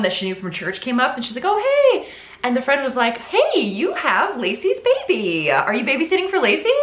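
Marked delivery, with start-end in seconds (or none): 1.4-2.1 very high, loud hammed-up hello; mock friendly greeting. Church friend greeting mother at park, mock friendly. 3.2-6.7 high, sing-song acquaintance; mock chirpy friend. Church friend asking about the baby, mock chirpy.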